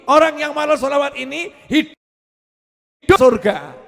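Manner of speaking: loud, high, slow preaching, hammering each line; fiery preaching. Acted. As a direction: preach it out to the whole hall. Preacher rises to full force. (ceramah berapi-api, suara keras dan tinggi)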